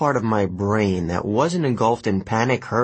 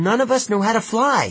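high, quick objection; urgent protest; 13 semitones higher, faster than usual. A narrator's reading. Boy balking at jumping from the window, protesting. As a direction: protest in a raised, hurried pitch. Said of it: "Nico protested".

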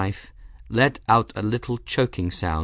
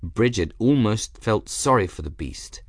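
ordinary delivery

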